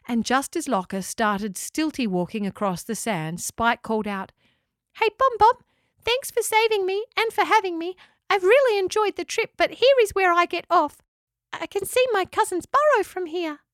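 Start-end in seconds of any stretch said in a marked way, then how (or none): 4.9-13.6 much higher, bright small-creature voice; chirpy little echidna. Acted. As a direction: squeak out a happy farewell. Spike the echidna says goodbye.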